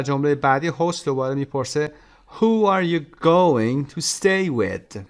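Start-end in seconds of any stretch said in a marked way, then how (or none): 3.2-5.0 going to stay with very slow and loud; rhythm demo. Shown as a demonstration. Teacher drilling stressed words of the question. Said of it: "Who, going, stay و with".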